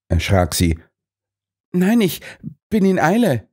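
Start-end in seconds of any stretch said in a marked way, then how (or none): 1.7-3.5 high, hurried; flustered woman. A narrator's reading. Miss Claxton hurries Holmes off, high and flustered. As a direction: brush him off nervously.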